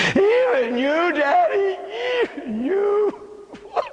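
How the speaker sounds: broken plea. Acted. Jesus calling to his Father, tearful and high. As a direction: plead like a forsaken child.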